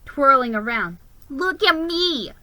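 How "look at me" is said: mock yell. A narrator's reading. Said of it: "he yelled".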